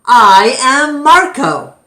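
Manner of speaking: a lightly voiced writer calling out; writer crying out. Writer announces himself to the crowd, loudly. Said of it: "cried another".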